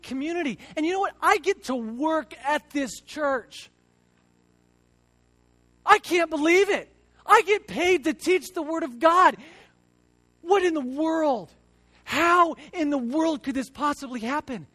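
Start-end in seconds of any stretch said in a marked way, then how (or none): 5.9-14.7 higher and louder, bursting with disbelieving joy; amazed joy. Real, not acted. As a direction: marvel out loud at your luck. Overjoyed at paid church job.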